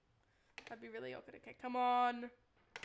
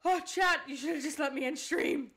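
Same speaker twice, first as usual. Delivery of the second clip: loud, raised cry of despair; anguished wail; 3 semitones higher, 10 dB louder than usual. Real, not acted. Streamer lamenting to chat about not ending stream, anguished wail. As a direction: wail it out in exasperation.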